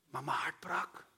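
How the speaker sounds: zacht gefluisterd, bijna breekt de stem; hushed whisper. Real, not acted. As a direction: fluister het, geraakt. Preek, emotioneel moment. (zacht fluisterend, ontroerd)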